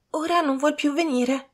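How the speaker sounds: high-pitched servant voice. A narrator's reading. Servant returning with news, high servant voice. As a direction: report it in a high voice.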